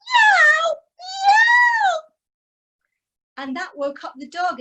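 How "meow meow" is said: very high, loud cat yowl; shrill yowling meow. Acted. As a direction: screech like a howling cat. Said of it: "the cat began howling and yowling".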